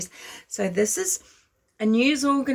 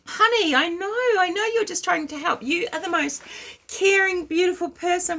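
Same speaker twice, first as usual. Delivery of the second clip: sugary high mock consoling voice, higher; mock consoling friend; 8 semitones higher than usual. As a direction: coo it like a fake sympathetic friend, higher. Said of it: "You'll be like".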